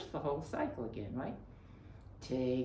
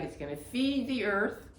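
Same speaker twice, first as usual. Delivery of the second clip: normal talking voice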